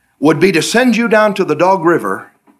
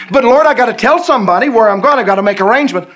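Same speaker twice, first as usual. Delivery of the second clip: high, loud pleading; pleading questions; 7 semitones higher, 3 dB louder, faster than usual. Retold or imitated. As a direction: beg God for answers. Believer objects to God.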